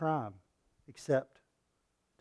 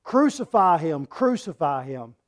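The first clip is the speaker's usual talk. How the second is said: crowd crying out; 2 semitones higher, 7 dB louder than usual. Acted. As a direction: chant it like an angry mob. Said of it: "they cried out".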